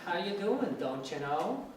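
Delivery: sing-song Minnesota accent; lilting Minnesota accent demo. Shown as a demonstration. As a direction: imitate a Minnesotan, tune rising and falling. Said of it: "they say like things like".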